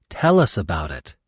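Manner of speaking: ordinary delivery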